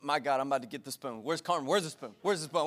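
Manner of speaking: low, quiet, fast muttering of a fed-up parent; mock angry mutter. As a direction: grumble under your breath, reaching for the spoon. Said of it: "my first reaction was".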